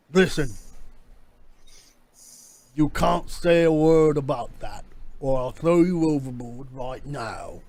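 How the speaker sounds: lightly voiced pirate captain, measured threat; pirate captain threatening. Captain swearing the stowaway to silence, lightly voiced threat. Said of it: "a typical pirate captain".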